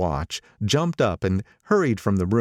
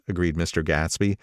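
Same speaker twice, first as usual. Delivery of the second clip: usual speaking voice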